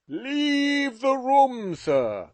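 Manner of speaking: father shouting at his son. A narrator's reading. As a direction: roar him out of the room. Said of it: "he shouted out".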